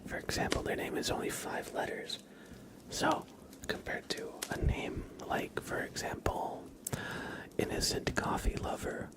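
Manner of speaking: soft unvoiced whisper close to the mic; hushed, breathy ASMR chatter. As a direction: whisper it all gently, ASMR style.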